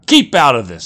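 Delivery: loud, high snap; snapping. Acted. Jack telling the bartender off, loud snap. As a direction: bark him off. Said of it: "said Jack".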